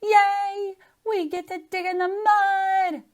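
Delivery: put-on high, loud child's cheer; excited shout. Girl cheers about digging in mud, excited shout. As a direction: shout it like a thrilled kid. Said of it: "I shouted".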